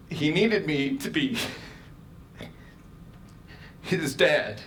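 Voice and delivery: crying through the words; ugly crying. Real, not acted. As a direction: voice wet with real tears. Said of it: "God, I'm ugly crying".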